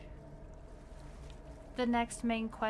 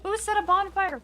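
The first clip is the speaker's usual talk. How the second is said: ordinary talk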